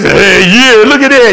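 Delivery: imitated whoop of a dad watching the game; dad cheering at TV. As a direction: holler at the television, chuckling.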